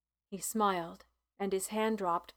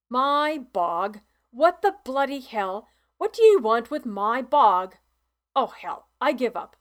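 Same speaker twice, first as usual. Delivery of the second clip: put-on loud, indignant old farmer; 2 semitones higher, 8 dB louder than usual. A narrator's reading. Mister Treadwell bristles at Ain about his bog, loud and indignant. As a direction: bristle at the stranger's request.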